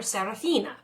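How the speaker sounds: ordinary delivery, nothing marked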